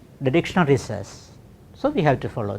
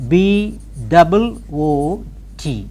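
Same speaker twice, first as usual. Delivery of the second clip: slow letter-by-letter dictation; spelled out; 3 dB louder, slower than usual. Shown as a demonstration. Teacher dictating words to transcribe, slowly spelled out. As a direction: spell it slowly for note-taking, letters apart. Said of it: "I shall spell each word".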